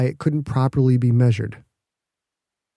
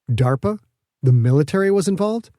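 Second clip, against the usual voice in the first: plain reading voice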